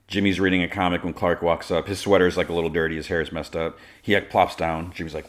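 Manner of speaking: usual speaking voice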